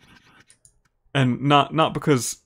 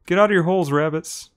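higher, louder call to the rabbits; 5 semitones higher, 4 dB louder than usual. Pretend. Player calling rabbits out of their holes, loud call.

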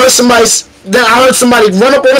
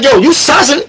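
high-pitched, heated challenge; retold challenge; 8 semitones higher than usual. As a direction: confront him as if about to swing. Retelling his challenge before punching.

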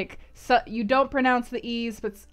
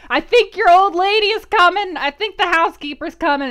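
high, loud, hurried-sounding alarm; retold panicky warning, pitched way up; urgent acted warning; 8 semitones higher, 9 dB louder than usual. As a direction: blurt it out high and loud to get him to let go. Acting Jane warning Rochester, urgent.

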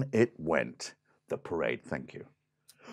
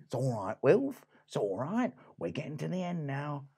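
land crab Reggie; 7 dB quieter than usual. Acted. Crab soothes the other crab.

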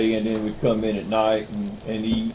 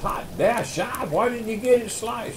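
much higher mock coach complaint; hammed-up old coach grumbling; 11 semitones higher than usual. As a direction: coach grumbling at Shive about the bacon. Coach voiced in story.

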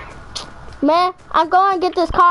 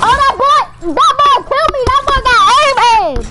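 excited yelling; 6 semitones higher, 5 dB louder than usual. Real, not acted. Hyped at an opponent's kill.

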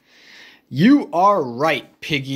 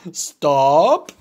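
Gerald voice; 4 semitones lower than usual. Acted. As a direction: lower big elephant voice. Gerald echoes.

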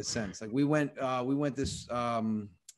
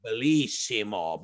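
louder put-on Italian praise; Italian accent; 3 semitones lower, 5 dB louder than usual. Owner repeats his pitch to every Italian guest, Italian accent. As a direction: announce it with an Italian flourish. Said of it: "says the same thing".

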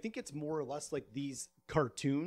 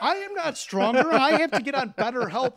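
higher, louder, indignant; loud protest; 7 semitones higher, 10 dB louder than usual. Real, not acted. Protesting to the friends he is not stronger, loud and high. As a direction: insist you're not stronger.

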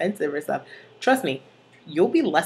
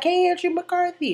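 sassy, much higher-pitched taunt; Santana; 13 semitones higher than usual. Acted. Santana addressing the Warblers, sassy high imitation. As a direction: call out with mock sass, pitched up high. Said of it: "So Santa walks in in her heels, and she's looking all sassy".

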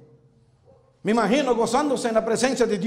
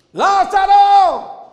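slow, booming call, much louder and higher; voice of God calling; 8 semitones higher, 8 dB louder, much slower than usual. Acted. God calls Lazarus from the tomb, booming. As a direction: stretch the name out like thunder. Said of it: "escuchó la voz de Dios".

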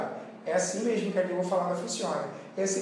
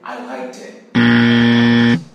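very slow, much louder and lower, stretching "liked"; teacher hamming up the wrong "liked" for Brazilian students; drawn-out loud demo; 8 semitones lower, 14 dB louder, much slower than usual. Shown as a demonstration. Loud, slow mock mistake. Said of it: "a nossa tendência em inglês é dizer".

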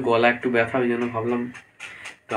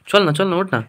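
louder, higher nagging; coaxing scold; 6 semitones higher, 5 dB louder than usual. Real, not acted. Husband nagging his sick wife to brush first, loud and higher. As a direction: push her up and to brush first.

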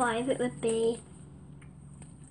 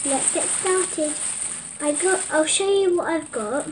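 normal talking voice

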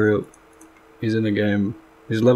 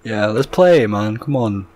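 ordinary talk, nothing marked

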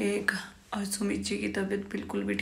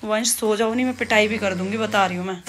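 louder stern scolding, quick threat; 5 dB louder than usual. Real, not acted. Mother scolding son at bedtime.

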